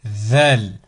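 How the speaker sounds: Zal with the tongue tip out between the front teeth; Zal, voiced "th" pushed out past the incisors. Shown as a demonstration. Teacher demonstrating the Arabic letter Zal, tongue between the teeth. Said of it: "la langue doit sortir au niveau des incisives".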